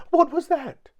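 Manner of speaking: very high, loud yelp of alarm; terrified shriek. A narrator's reading. Mister Jelly hears a twig snap, terrified shriek. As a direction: shriek it in a tiny squeaky voice. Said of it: "he shrieked".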